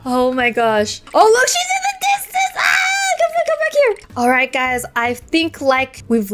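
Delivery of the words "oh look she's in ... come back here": high shouts, calling her back; panicked shouting. Real, not acted. Fleeing the angry waitress, he shouts high after her. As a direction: yell across the distance in alarm.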